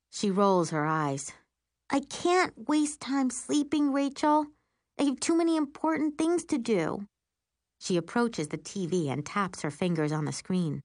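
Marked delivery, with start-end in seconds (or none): 1.9-7.1 high-pitched sister voice, insistent; busy, earnest sister. A narrator's reading. Sister tells Rachel why she won't sleep, high insistent voice. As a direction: pitch it up for the self-important sister.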